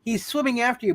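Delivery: ordinary talk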